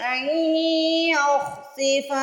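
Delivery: slow melodic tajweed chant; Quran recitation. Shown as a demonstration. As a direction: recite slowly, drawing out the vowels. (धीमी सुरीली तिलावत)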